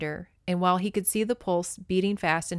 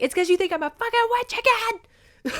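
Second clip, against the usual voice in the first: indignant quote; 3 dB louder than usual. Retold or imitated. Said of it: "she's like".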